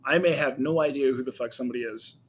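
normal talking voice

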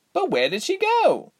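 high-pitched alarmed question. Acted. Reshma asking after missing Ilse, high and alarmed. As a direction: ask it urgently, voice leaping up.

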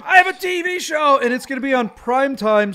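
high, loud, gleeful announcement; hammed-up thrilled boast; mock excitement. Answers co-host's feigned amazement excitedly.

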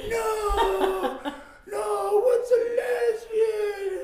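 loud, very high drawn-out wail; hammed-up childish bawling; mock crying kids. Kids answering their crying mom, bawling in confusion. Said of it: "you're, you're gonna cry".

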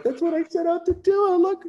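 very high, fast squeal of delight; high giddy mock excitement; gushing squeal. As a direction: squeal it like a thrilled fan.

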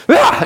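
high-pitched mock yelp of fright; imitated startled scream. Preacher mimicking Katie scared in the maze, high startled shriek. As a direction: yelp as if jumped at in a haunted maze. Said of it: "every time she gets scared".

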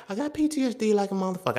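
high-pitched, exasperated insistence; worked-up insistence. Real, not acted. Man insisting on his trauma, worked up and high. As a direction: pitch it way up in protest.

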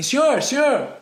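high-pitched mock shout for attention; retold calling out. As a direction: call across the aisle as the salesman. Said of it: "ele grita pra mim". (imitando vendedor chamando alto)

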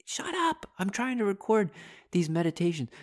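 high-pitched, exasperated squeal at the birds; mock shout at birds. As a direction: squeak it out like a fed-up kid at noisy birds. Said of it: "He's like".

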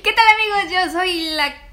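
loud, high show-host hello; host greeting. Acted. Opening the podcast as host.

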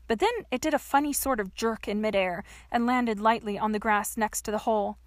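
usual speaking voice

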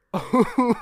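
a loud, high burst of laughter. Real, not acted. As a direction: crack up at the idea. Laughs at the pump fake.

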